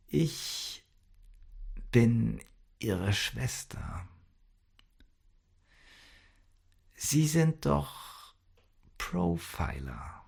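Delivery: slow, soft and broken by long pauses; halting confession. A narrator's reading. Sister reveals herself to the profiler, hushed and halting. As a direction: force it out word by word, barely voiced.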